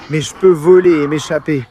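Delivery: normal talking voice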